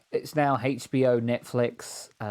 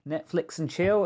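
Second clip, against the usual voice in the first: lightly raised, higher mock call; pretend shout; 6 semitones higher, 4 dB louder than usual. Pretending to shout out to a mate, mild mock shout. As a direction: pretend to call out, only half loud. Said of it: "you're pretending to shout out".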